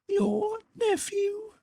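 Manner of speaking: very high, thin otherworldly voice; ghostly spirit voice. A narrator's reading. The Ghost tells Scrooge of his sister, high and otherworldly.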